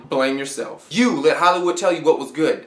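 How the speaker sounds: usual speaking voice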